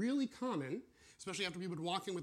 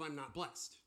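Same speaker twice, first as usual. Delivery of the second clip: ordinary talk, nothing marked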